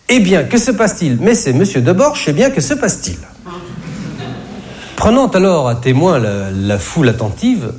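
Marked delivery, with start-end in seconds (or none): 0.1-3.2 léger rôle de chef de rayon, plus haut; s'enquérir avec un soupçon d'importance de petit chef; petit chef qui arrive. Acted. (petit chef affairé, légèrement joué)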